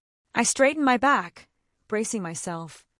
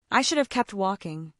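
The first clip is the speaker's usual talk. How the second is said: usual speaking voice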